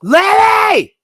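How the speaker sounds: high, loud drawn-out holler; calling out. Real, not acted. Streamer hollering for his old lady, loud long call. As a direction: yell for someone out of the room. Calling partner from another room.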